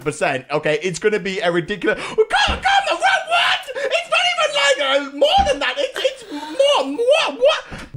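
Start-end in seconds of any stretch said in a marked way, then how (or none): 2.0-7.9 very high squealing disbelief; outraged disbelief. Real, not acted. Player stunned at the poll result, high spluttering outrage. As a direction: splutter in a high incredulous shout.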